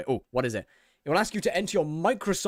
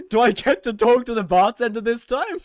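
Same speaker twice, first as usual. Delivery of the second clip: excited exasperation; 7 semitones higher, 7 dB louder than usual. Real, not acted. Mock hopeful complaint.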